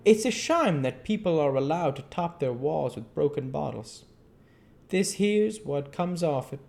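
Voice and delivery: put-on a genial old keeper spinning a yarn; an old keeper chatting to a reporter; old zookeeper. A narrator's reading. Keeper fusses over wolf.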